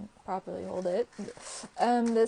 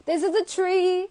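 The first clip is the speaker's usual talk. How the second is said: high, louder mock announcement; silly high voice; 9 semitones higher, 6 dB louder than usual. Showing viewers the tree nail, silly high announcement. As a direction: pipe it up in a silly voice.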